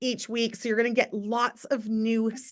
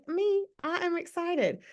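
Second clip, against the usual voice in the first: higher, mock-eager voice; eager mock excitement; 7 semitones higher, 4 dB quieter than usual. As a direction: play an eager volunteer raising her hand. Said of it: "She's like".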